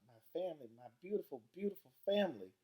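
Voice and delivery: put-on weeping Southern accent. A narrator's reading. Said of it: "that Southern style accent and all that weeping".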